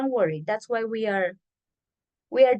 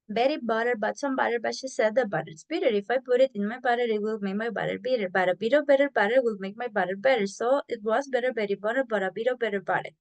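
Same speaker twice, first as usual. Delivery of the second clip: fast, fluent demo of the twister; fast tongue twister; faster than usual. Shown as a demonstration. Teacher to class, showing the full-speed tongue twister, fast. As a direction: rattle it off quickly. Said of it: "That's how you're going to say it".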